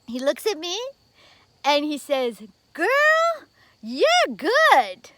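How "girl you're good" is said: high, loud burst of delight; delighted praise. Retold or imitated. Reverend amazed by his interpreter, loud delighted praise. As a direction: blurt out the compliment, thrilled.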